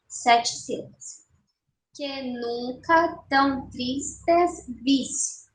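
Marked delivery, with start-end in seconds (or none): 1.9-5.4 "Que nunca tão tristes vis" slow, one syllable at a time; escansão silábica. Shown as a demonstration. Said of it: "vamos fazer a escansão". ("Que nunca tão tristes vis" devagar, sílaba por sílaba)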